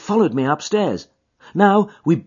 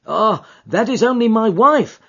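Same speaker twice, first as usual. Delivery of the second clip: raised, urgent cry; cried out; 6 semitones higher, 4 dB louder than usual. A narrator's reading. Commissionaire protesting to the clerk, crying out. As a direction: call it out quickly, a touch higher. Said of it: "cried the commissionaire".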